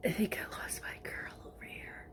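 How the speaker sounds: breathy whispered aside. Real, not acted. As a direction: drop to a whisper, as if confiding. Quiet aside about her hair.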